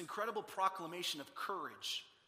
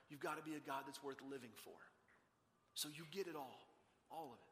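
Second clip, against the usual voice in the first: soft prayer; 3 semitones lower, 11 dB quieter than usual. Pretend. Voiced prayer of trust.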